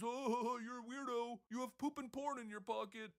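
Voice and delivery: high, laughing mock politician voice; hammed-up impression of a dismissive governor; mocking Newsom. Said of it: "Gavin Newsom just says".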